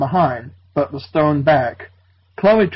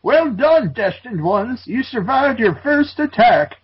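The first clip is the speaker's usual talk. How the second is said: much higher, hammed-up proud mentor; proud old doctor; 7 semitones higher than usual. Old doctor praising the young heroes, mock proud. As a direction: congratulate them grandly. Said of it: "said proudly".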